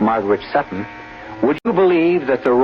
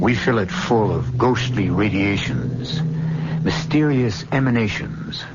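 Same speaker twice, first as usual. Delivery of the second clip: eerie host welcome; 2 semitones lower than usual. Acted. Host welcomes listeners into the dark theater, eerie and hushed.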